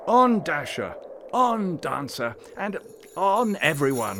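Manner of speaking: loud, high calls to a team; rousing sleigh-driver's shout, each call drawn out; shouted command. A narrator's reading. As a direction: drive them on with big, ringing shouts. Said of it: "he shouted".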